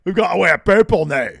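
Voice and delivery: loud Scottish-style wrestler voice; Joe Coffey impression. Host impersonating a wrestler on request, loud Scottish accent. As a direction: bellow it like a Glasgow brawler. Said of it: "in the style of Joe Coffey".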